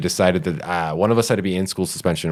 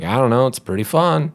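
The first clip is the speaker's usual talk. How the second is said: mom voice pitched higher, a bit louder; breezy mock mom, shrugging it off; carefree mom; 8 semitones higher, 3 dB louder than usual. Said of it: "And my mom was like".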